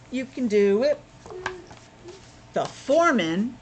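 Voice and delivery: ordinary delivery, nothing marked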